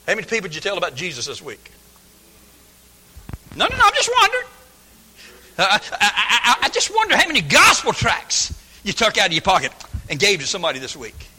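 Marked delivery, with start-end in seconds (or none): 3.6-7.3 high falsetto innocent aside; squeaky feigned innocence; mock innocent. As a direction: play it coy and harmless.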